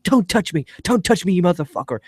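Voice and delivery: high-pitched mock outburst; imitated angry protest. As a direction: act out an indignant warning-off. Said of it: "And Robin's just like".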